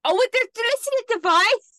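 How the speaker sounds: high, loud delighted gasp; sudden delighted recall; excited realisation. Real, not acted.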